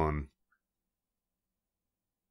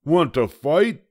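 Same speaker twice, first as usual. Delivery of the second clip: high, loud, grumpy little bug; a tiny bug spoiling for a fight, high and loud; grouchy ladybug; 10 semitones higher, 7 dB louder than usual. A narrator's reading. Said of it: "said the grouchy ladybug".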